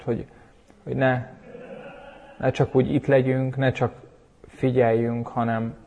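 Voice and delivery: soft, quiet closing prayer. Real, not acted. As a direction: pray softly to God, unhurried. (halk, csendes ima)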